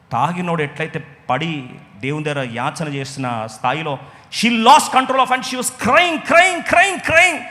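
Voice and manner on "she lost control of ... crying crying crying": high, slow, loud wailing repetition; preaching cry. Acted. Preacher describing Hannah's tears, slow and high. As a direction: stretch each crying out higher.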